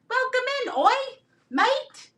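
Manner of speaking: very high, squeaky mock-Australian accent; bad Aussie accent. Seller welcomes viewers in, bad Aussie accent. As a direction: greet in a badly faked Aussie accent. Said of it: "I never said I was good at".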